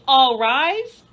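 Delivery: booming judge voice; mock judge call. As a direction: announce it like a judge opening court. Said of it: "bang your gavel and say".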